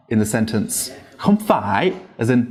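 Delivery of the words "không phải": phải dipping then swooping up high; emphatic denial, phải climbing sharply; strong rising ending. Shown as a demonstration. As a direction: stress phải with a big rise at the end. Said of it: "The really strong rising ending".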